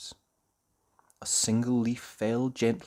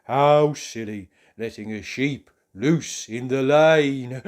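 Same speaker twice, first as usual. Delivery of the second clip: slow, louder fussy character voice; tut-tut at the careless farmer; 7 dB louder, slower than usual. Acted. Mister Forgetful grumbling about the loose sheep, slow fussy voice. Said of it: "thought Mister Forgetful".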